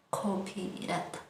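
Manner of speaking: copirata said slowly and softly, each syllable clear; slow teacher model of copirata; slow model word. Shown as a demonstration. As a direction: draw out copirata for learners to repeat. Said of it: "repitan después de mí".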